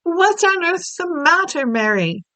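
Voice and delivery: lightly voiced Queen, concerned; a hint of a worried Queen. The Queen asking her pale maid what's wrong, mildly concerned. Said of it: "a famous voice was saying".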